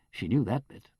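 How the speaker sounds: ordinary delivery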